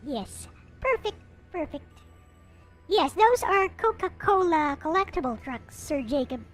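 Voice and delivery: ordinary delivery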